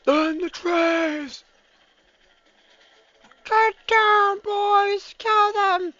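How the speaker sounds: high-pitched commander shouting orders; high battle cry voice. Pretend. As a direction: bark orders in a high squeaky voice.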